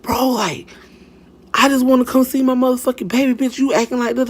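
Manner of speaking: high-pitched, rushed mock complaint; fast, high imitation of an arguing woman; baby mama impression. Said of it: "his baby mama like".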